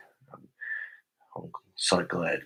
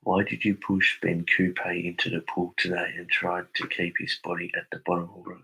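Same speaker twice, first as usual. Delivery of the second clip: usual speaking voice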